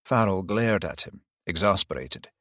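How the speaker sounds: ordinary delivery, nothing marked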